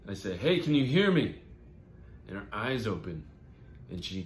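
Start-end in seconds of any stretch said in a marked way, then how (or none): 0.4-1.4 loud, raised voice checking for a response; urgent call. Acted. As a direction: call out high and hard to rouse the injured. Calls to injured crash victim.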